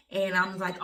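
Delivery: ordinary talk, nothing marked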